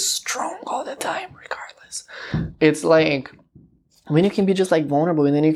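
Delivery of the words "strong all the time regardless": whisper. Real, not acted. Confiding aside to viewers.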